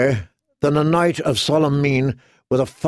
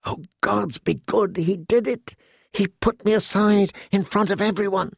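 higher, softer squeal of delight; muffled joy; 7 semitones higher, 3 dB quieter than usual. A narrator's reading. Girl hides her joy in her pillow, soft gleeful squeal. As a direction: gush into the pillow. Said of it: "to muffle a squeal of joy".

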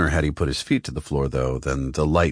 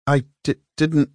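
nervous stammer, higher; 9 semitones higher than usual. A narrator's reading. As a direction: trip over the words. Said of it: "he stammered".

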